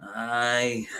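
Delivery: high, drawn-out Glaswegian drawl; hammed-up Glasgow boozer; Glaswegian accent. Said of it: "I put on a Weedy accent".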